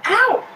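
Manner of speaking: sudden loud ow; real yelp. Real, not acted. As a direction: yelp as the dog nips you. Pet bites her.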